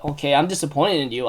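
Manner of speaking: hushed, deflated quote, let down; quiet disappointment. Retold or imitated. Kyo tells Tohru she let him down, quietly disappointed. Kyo's crushing line, acted out.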